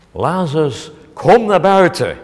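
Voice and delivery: loud commanding call, rising on kom; full of authority. Acted. Jesus at Lazarus's grave, loud authoritative command. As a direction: order the dead out with authority. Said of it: "vol autoriteit roepen".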